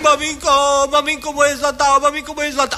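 high, panicked wail; wailing for mummy. Acted. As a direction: cry out for his mother in terror. Terrified soldier calls mother.

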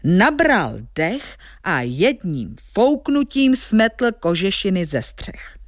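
plain reading voice

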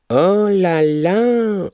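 high, long drawn foppish exclamation; affected excuse. A narrator's reading. Young man excuses a wrong lead, affected. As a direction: sing out a dainty "oh dear". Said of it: "omlouval chybné vynesení".

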